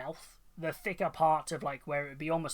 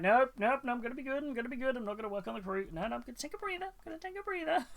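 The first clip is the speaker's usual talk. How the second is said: high, squeaky self-scolding voice; mock self-scolding; 8 semitones higher, faster than usual. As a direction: talk yourself out of it, pitched up and laughing. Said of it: "but I'm like".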